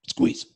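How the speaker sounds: sharp gasp, strained squeeze; a sharp gasp, then squeeze out the word. Shown as a demonstration. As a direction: gasp and bear down. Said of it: "One more at the top".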